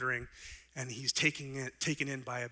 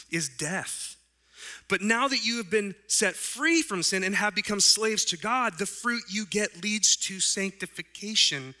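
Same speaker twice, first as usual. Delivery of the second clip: fired-up preaching; 6 semitones higher, 6 dB louder than usual. Acted. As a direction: preach Paul's words with fire. Preaching Paul's verses.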